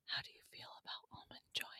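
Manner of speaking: fast unvoiced whisper; weird whisper. Real, not acted. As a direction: whisper it quickly and oddly. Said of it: "That was a weird whisper".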